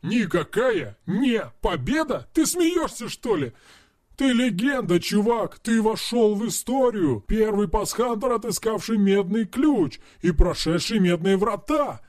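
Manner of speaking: high, disbelieving hype; incredulous exclaiming. Acted. Friend hyping the hero up in disbelief. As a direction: shout how legendary he is.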